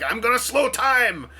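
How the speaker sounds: high, loud, drawn-out battle cry; mock yell. Imitating Hot Rod yelling every time he shot, mock yell. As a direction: yell it like a hammed-up action catchphrase. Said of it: "he kept yelling something every time he shot".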